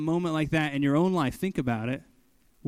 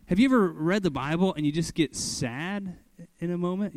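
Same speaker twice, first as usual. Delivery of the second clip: normal talking voice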